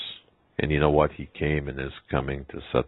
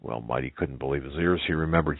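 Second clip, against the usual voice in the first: usual speaking voice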